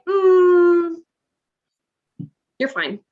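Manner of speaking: mock the staff waving her off; hammed-up dismissive Mm, you're fine; dismissive brush-off. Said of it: "And we're like".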